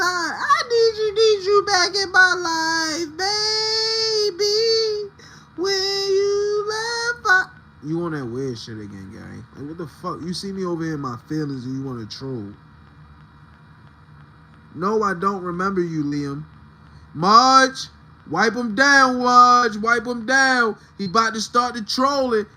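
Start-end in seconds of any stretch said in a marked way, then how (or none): 0.0-7.4 high, loud, slow fake sobbing; high, loud hammed-up weeping song; mock crying plea. Mock crying serenade again. 17.1-20.8 loud order to the mod; loud call to the moderator Marge. Real, not acted.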